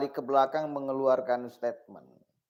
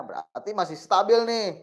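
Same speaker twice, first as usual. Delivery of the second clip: ordinary delivery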